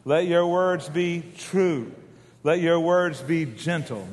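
very slow, each line on its own; slow recitation. Shown as a demonstration. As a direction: recite it slowly for the crowd to echo. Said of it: "Say it with me now".

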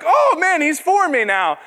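high, loud burst of delight; thrilled reaction. Retold or imitated. Young man won over by his leader's support, thrilled.

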